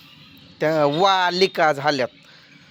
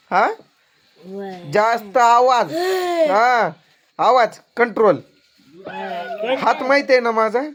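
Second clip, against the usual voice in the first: angry scolding; 5 semitones higher than usual. Real, not acted. Father scolding the son.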